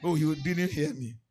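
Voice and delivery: usual speaking voice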